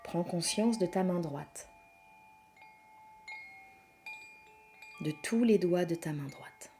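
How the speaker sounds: soft, hushed yoga nidra voice with long pauses; guided meditation. Acted. Leads a yoga nidra relaxation.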